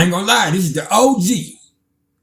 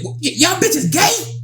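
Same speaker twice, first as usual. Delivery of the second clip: high, slow, stuttered mock question; incredulous retelling; 13 semitones higher, slower than usual. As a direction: imitate a stunned man in a squeaky-high voice. Retold brother's startled question.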